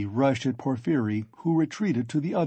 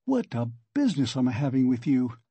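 usual speaking voice

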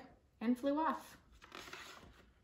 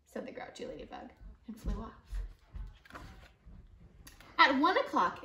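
ordinary delivery

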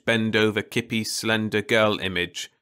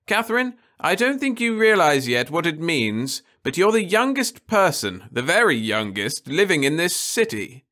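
raised, urgent motherly voice; a mother pressing her point; earnest mother; 9 semitones higher, 4 dB louder than usual. A narrator's reading. Mother urging her daughter to grasp the situation, raised and earnest.

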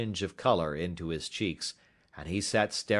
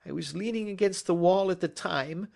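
higher-pitched voice of an anxious culprit; confessing culprit; 14 semitones higher, 4 dB louder than usual. Acted. Culprit recounts his theft to the detective, anxiously confessing. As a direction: confess it all, uneasy and earnest.

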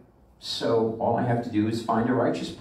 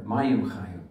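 ordinary delivery

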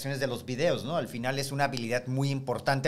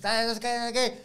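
mock student whine; 8 semitones higher than usual. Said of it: "student mocked".

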